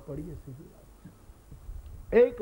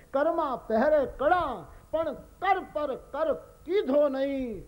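slow, higher, louder recitation of "Karma પહેરે કડા"; recited couplet; 9 semitones higher, 3 dB louder, slower than usual. Shown as a demonstration. Preacher reciting the folk couplet to the congregation, slow and declaimed. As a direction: declaim the verse slowly. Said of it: "હાચું હોરઠિયો ભણે".

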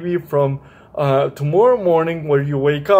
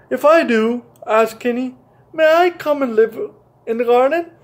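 put-on hopeful child; 7 semitones higher than usual. Kenny asks the rooster, high.

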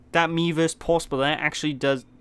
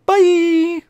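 very high, loud cracking shout; voice-cracking bye; 12 dB louder than usual. Real, not acted. Sign-off to viewers, shouted high with a voice crack. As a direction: yell bye till the voice breaks. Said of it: "my voice broke".

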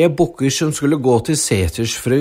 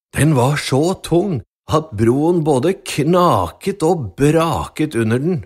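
usual speaking voice

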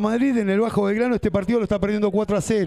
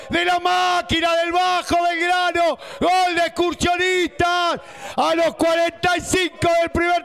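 ecstatic goal call; 9 semitones higher than usual. Real, not acted. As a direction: shout the goal with pride. Celebrating home side's goal.

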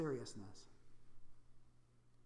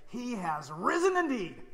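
higher, louder, slow congregational reply; slow jubilant answer; joyful response; 6 semitones higher, 5 dB louder, slower than usual. Acted. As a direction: ring it out like the whole church answering. Easter call and response.

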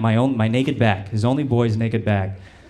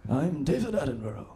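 lightly put-on, slightly higher documentary host voice; a hint of the hushed nature presenter introducing himself; David Attenborough impression; 5 semitones higher, 9 dB quieter than usual. Comic imitating the Planet Earth guy for the crowd, lightly higher and softer. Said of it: "Do you know the Planet Earth guy?".

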